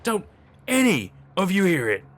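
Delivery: loud, high desperate appeal; frightened, desperate. A narrator's reading. Hutch appealing to his friends, frightened and desperate. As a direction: burst out, pleading to be believed. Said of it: "but still frightened".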